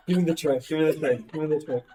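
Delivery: ordinary talk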